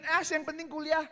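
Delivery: loud, high mocking voice; hammed-up slacker voice; mock careless student. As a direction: toss it off like a careless kid.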